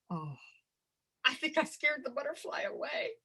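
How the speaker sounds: ordinary talk, nothing marked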